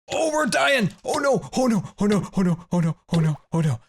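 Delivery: frantic cries of oh no; panicked wailing. Real, not acted. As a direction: wail in real alarm as you die. Panicking as character dies in game.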